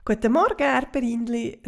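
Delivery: cheerful fairy greeting over the garden fence; calling out a greeting. Acted. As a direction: Heidi calling to her neighbour over the fence. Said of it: "ruft Heidi der Schabe zu".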